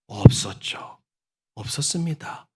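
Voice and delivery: usual speaking voice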